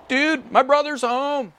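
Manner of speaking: high and loud, delighted he's home; excited brother. Acted. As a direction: cry out the joy of a brother's return. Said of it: "he would go".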